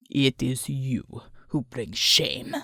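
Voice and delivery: low, slow spitting contempt; spat contempt. A narrator's reading. The thug spits contempt at the old woman, low and slow. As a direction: spit the words back at her. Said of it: "he spat at the old woman".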